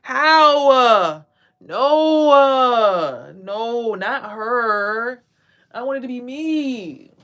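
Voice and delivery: high, loud protesting voice; mock outrage, loud and high; mock jealous whining. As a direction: whine like a jealous rival, no, not her. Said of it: "They're like".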